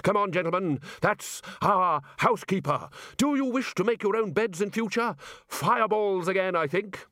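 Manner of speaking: jolly the others along; lightly voiced bluff Ridcully rallying his staff; hearty headmaster wizard.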